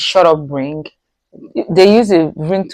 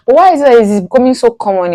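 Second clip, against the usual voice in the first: indignant; 6 semitones higher, 5 dB louder, faster than usual. Real, not acted. Indignant question about cohabiting.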